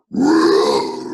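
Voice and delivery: loud, low, rolling roar; lion roar. A narrator's reading. Storyteller imitating hungry lions, big low roar. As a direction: roar long and deep like a lion. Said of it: "And lions went".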